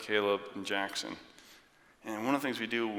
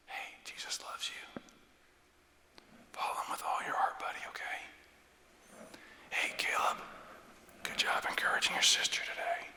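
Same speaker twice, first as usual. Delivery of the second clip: breath-only bedtime whisper; 5 dB quieter than usual. Acted. Parent blessing each child at bedtime, intimate whisper. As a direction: whisper encouragement into a child's ear. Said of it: "we whisper in their ear".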